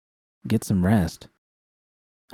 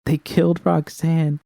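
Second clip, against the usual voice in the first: high, strained disbelief; distraught protest; 7 semitones higher than usual. Acted. Narrator reports the gang burned the place down, voice pitched high in distress. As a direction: voice climbing high in horror.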